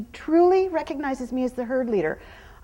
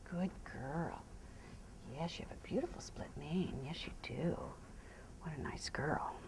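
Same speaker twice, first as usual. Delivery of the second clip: much softer, lower loving praise; soft whispered praise; 9 semitones lower, 19 dB quieter than usual. Real, not acted. Trainer admiring her horse's mane, soft whispered praise. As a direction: murmur fondly to the horse.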